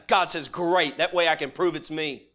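loud, driving preacher cadence; full-force preaching. Acted. Preacher rousing his congregation, loud full-force preaching.

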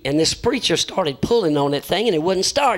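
normal talking voice

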